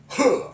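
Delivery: sharp high karate huh; karate grunt. Shown as a demonstration. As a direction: grido secco di karate. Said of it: "karate math".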